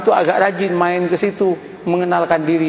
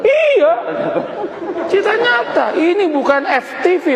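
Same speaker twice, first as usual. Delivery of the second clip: excited insistence; 10 semitones higher than usual. Real, not acted. Answers listener, insisting it's true.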